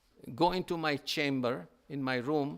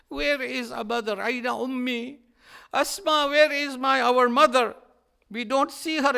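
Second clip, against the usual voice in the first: higher, louder, urgent asking for their mother; anxious children; 8 semitones higher, 7 dB louder than usual. Acted. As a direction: call out like worried boys searching the house.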